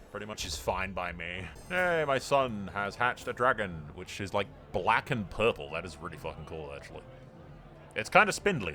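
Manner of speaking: ordinary delivery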